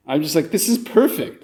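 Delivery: ordinary talk, nothing marked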